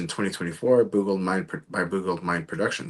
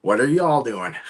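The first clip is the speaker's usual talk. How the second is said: playful Texan y'all, a bit louder; mock Texan; 4 semitones higher, 6 dB louder than usual. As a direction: lean into a light Texan twang on y'all. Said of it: "uh, Texan".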